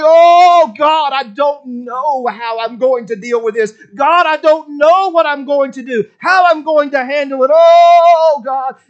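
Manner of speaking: loud, high, anguished crying out to God; a loud pleading cry to God; groaning prayer. Acted. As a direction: pour out a desperate groan of prayer. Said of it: "you're groaning".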